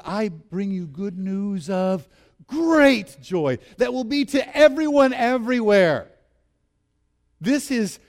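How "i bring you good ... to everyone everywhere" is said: slow and building, ending louder and higher; grand announcement. Acted. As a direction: proclaim it like an angel with big news. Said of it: "No, he says".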